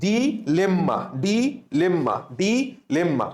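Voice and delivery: ডিলেম্মা chanted as "di, lemma"; repeat-after-me drill. Shown as a demonstration. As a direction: split ডিলেম্মা after ডি, evenly each time. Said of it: "আমার সাথে বলো".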